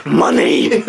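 loud comic shout. Pretend. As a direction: blurt it out loud. Shouting the punchline.

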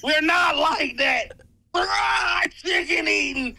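slow, high, wailed list; hammed-up tearful protest; mock mental breakdown. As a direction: drag each item out as if falling apart. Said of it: "we just witnessed a fucking mental breakdown".

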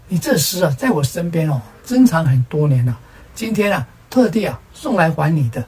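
ordinary delivery, nothing marked

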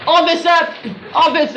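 high, urgent calls; calling for help. Acted. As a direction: summon someone from across the way. Calling the policeman over.

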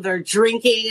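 ordinary talk, nothing marked

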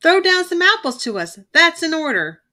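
loud, high commanding gang leader; bossy bully. A narrator's reading. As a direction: order him around like a boss. Bully ordering gang member.

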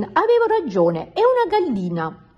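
high, triumphant vindication; high-voiced, self-satisfied exclamation; exclaimed told-you-so. A narrator's reading. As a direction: crow that you were right, pitched high. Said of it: "esclamò Marilyn".

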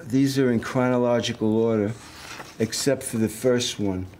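normal talking voice

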